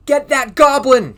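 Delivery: slow, loud, high mob shout; angry shout. A narrator's reading. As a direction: bellow it like an angry crowd. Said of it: "shouted everybody".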